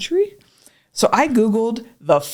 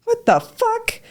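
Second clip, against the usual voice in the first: high, baffled exclamation; incredulous; much slower than usual. Retold or imitated. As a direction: gasp it out in disbelief. Stunned reaction.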